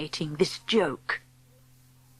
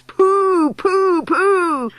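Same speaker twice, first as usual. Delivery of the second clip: high, hooting crowd jeer; disgusted cry; 10 semitones higher, much slower than usual. A narrator's reading. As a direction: wail each "pooh" in revulsion. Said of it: "cried the audience".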